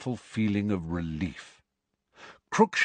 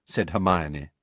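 ordinary delivery

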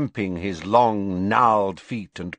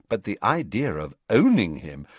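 ordinary delivery, nothing marked

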